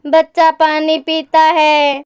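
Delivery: ordinary delivery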